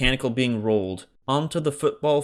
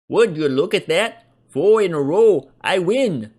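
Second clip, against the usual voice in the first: gloating; 8 semitones higher, 8 dB louder than usual. Acted. Gloating winner's voice.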